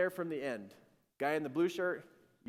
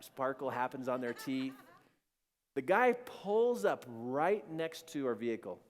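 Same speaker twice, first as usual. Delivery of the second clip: ordinary talk, nothing marked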